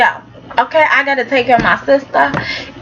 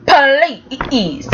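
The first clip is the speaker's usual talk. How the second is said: ordinary talk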